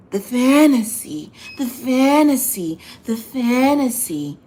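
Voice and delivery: high, slow, stretched the fantasy three times; drawn-out emphasis. Real, not acted. Drawing out the fantasy to fans, slow and high. Repeating the phrase for effect.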